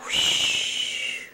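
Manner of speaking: long airy whoosh; whooshing noise. Shown as a demonstration. Mother shows baby how the toy football goes, whooshing. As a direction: draw out a rushing whoosh. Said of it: "it goes whoosh".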